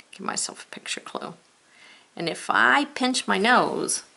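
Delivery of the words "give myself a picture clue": soft whispered aside. Real, not acted. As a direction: say it under your breath. Whispered aside while drawing.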